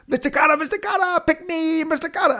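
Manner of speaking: very high, strained eager whine; Horshack impression. Horshack begging the teacher to call on him, high whine. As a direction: squeal it like a desperate kid in class. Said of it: "It was Horshack, a character on the show, saying".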